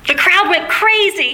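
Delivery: normal talking voice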